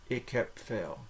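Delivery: heb ending in a P, "hep". Shown as a demonstration. Teacher models a Dutch phrase, B like P. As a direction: say heb with its B hardened to a P. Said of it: "it's pronounced like a P".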